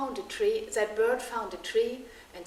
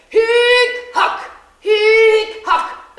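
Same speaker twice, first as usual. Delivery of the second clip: loud hacking chant; 12 semitones higher, 19 dB louder, much slower than usual. Acted. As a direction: bang out the work rhythm. Millers hacking stone.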